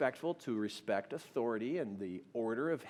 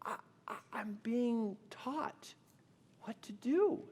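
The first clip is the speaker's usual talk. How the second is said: halting, high and slow; whispered start into a high, weepy voice; retold tearful wife; 9 semitones higher, slower than usual. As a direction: imitate wife barely getting words out through tears. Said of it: "tears streaming down her face".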